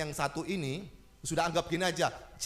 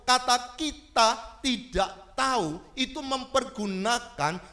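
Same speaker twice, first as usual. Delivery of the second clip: loud, high preaching voice, driving each question home; forceful preaching; 7 semitones higher, 7 dB louder than usual. Acted. Preacher pressing the congregation about prayer, loud and forceful.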